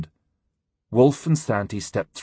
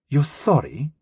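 cold sarcastic scorn, higher pitch; icy contempt; 5 semitones higher, slower than usual. Acted. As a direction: sneer the words back coldly. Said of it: "Glein's voice sounded icy with contempt".

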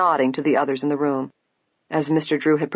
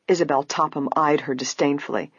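ordinary delivery, nothing marked

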